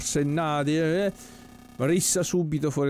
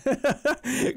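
real laugh; 11 semitones higher than usual. Real, not acted. Laughs while naming restaurant.

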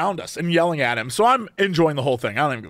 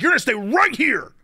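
higher-pitched mock shout; angry order; 6 semitones higher than usual. Angry man to driver who wants to pull over, mock shouting. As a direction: imitate an irate man laying down orders. Retold quarrel, man refuses to move.

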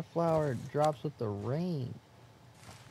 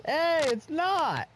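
high, slow, stretched-out protest; indignant protest; 4 dB louder, much slower than usual. Real, not acted. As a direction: cry out in mock outrage. Defensive protest to friend.

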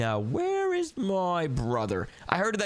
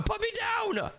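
shrill squeal of protest; high panicked yell. Acted. Carried outside by brother, high yelling protest. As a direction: yelp to be put down.

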